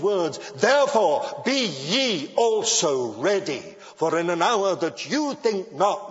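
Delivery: preaching at full force. Acted. Preacher urging his congregation to be ready, full force. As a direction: drive it home from the pulpit.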